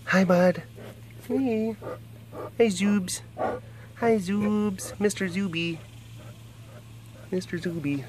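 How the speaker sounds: soft high pet-talk; cooing to a cat. Real, not acted. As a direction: coo gently to a pet.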